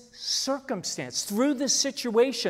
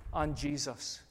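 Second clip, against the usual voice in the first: ordinary delivery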